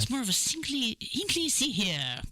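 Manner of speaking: a hammed-up, badly faked British accent, slowing down on the second Hinkley; mock a British local with a clumsy British accent; a deliberately bad British accent, jokey; terrible British accent. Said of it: "That was a terrible, terrible accent".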